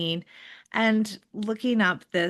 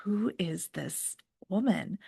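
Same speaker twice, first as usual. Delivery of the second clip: hushed, breathy under-the-breath question; mock whisper to herself; whispered aside; 6 dB quieter than usual. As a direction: whisper it so she can't hear. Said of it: "I was like".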